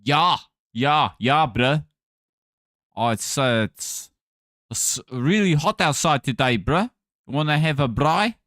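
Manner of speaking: laid-back South African caricature; South African chatting to a bruh; South African accent. Shown as a demonstration. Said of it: "I can do a South African accent".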